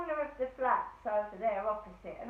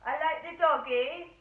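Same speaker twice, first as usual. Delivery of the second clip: loud, higher cooing yell; 4 semitones higher, 10 dB louder than usual. Retold or imitated. Driver yelling to the dog in front of neighbours, loud coo. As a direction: call out to the dog so the whole street hears. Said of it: "the driver's yelling out".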